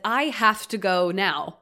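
loud, slow angry outburst, imitated; exploding. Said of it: "in those moments exploded".

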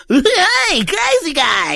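high, loud goofy cartoon voice; hammy wacky toon character; vintage cartoon voice. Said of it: "Kids wanna hear".

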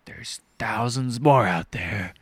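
low, slow, grim drawl; Matthew McConaughey. Retold or imitated. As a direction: imitate a brooding actor killing the mood. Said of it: "Matthew McConaughey shows up. He's like".

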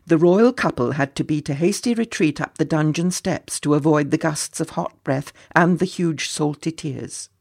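ordinary delivery, nothing marked

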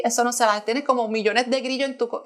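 usual speaking voice

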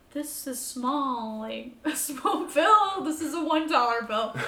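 very slow, deliberate explaining; slow teaching voice. Retold or imitated. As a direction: explain bills to a child slowly. Parent teaching blind child.

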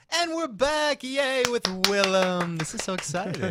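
high, loud, upbeat welcome back; podcast host intro. Acted. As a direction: announce the guest, bursting with energy. Host returns from the break.

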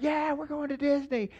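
high but soft mock cheer; hushed, high-pitched pretend excitement; imitating excited kids. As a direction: voice the kids' excitement in a small high voice. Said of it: "they seemed excited".

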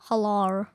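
"halar" with a drawn-out ar ending; Australian-style hello. Shown as a demonstration. Kid showing how they say hello, "halar" ending in long ar. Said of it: "that's how I say it".

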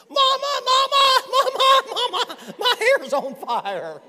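high, very loud cry for mama; mock panicked scream. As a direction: shriek it like a hammed-up emergency, drawing out the end. Mimics girl whose hair caught fire.

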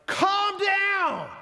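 high, loud exasperated yell of calm down; frustrated parent shout. Retold or imitated. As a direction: snap at an anxious kid like a fed-up parent. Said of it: "as a frustrated parent".

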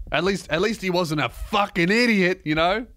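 normal talking voice

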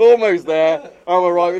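loud, high, laughing porn parody; hammed-up British porno voice. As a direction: overplay the cheesy moan, laughing. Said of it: "the most British porno ever".

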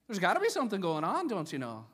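quick, loud mock-Irish, starting high and dropping; dubious half-Irish accent. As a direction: toss it out in a muddled Irish accent. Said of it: "I'm not even sure what accent that is".

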